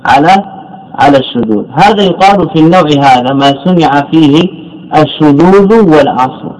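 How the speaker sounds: normal talking voice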